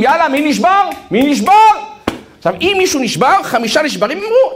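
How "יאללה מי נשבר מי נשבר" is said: commanders' taunting yell. Retold or imitated. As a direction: taunt the freezing soldiers to quit. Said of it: "המפקדים צועקים".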